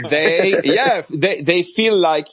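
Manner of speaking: loud, high, through real laughter; amused outburst. Real, not acted. Host confirming a guest's translation of an idiom, amused and loud. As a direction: keep talking as the laugh spills out.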